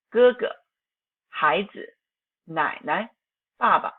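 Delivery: 哥哥, 孩子, 奶奶, 爸爸, each second syllable short and soft; neutral tone endings. Shown as a demonstration. Teacher gives examples, neutral tone on second syllables. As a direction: clip the last syllable of 哥哥、孩子、奶奶、爸爸 lightly. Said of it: "pronounced softly and is short like a staccato".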